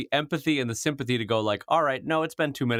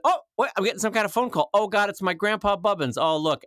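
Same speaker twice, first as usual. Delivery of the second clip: hammed-up higher "oh, what?"; mock surprise; 7 semitones higher than usual. Escaping a fan, faking surprise at a call. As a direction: fake being startled by a phone call. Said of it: "I'd be like".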